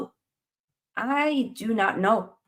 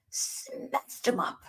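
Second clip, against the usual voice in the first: whispered threat; 8 dB quieter, much slower than usual. Acted. After shouting, streamer whispers the threat to chat. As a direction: hiss it like a secret plan.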